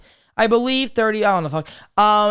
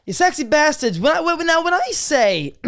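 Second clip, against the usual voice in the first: voice cracking; 10 semitones higher than usual. Real, not acted. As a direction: voice cracks up high. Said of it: "little voice crack there".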